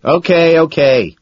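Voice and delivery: loud hurried okay; shouting. A narrator's reading. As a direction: shout it back. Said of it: "I shout".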